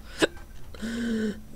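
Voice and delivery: a sudden sharp hiccup. Real, not acted. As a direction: catch a hiccup mid-breath. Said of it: "that was a hiccup".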